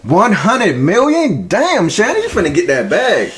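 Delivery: high-pitched, drawn-out disbelief; amazed reaction. Real, not acted. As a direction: stretch it out high in astonishment. Reacting amazed to the deal size.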